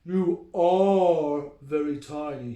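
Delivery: drawn-out grumpy troll. Acted. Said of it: "he agreed".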